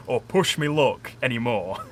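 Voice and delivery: Yorkshire "me luck", laughing through it; broad Yorkshire pub talk, with a laugh. Shown as a demonstration. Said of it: "like you'd talk if you were down pub".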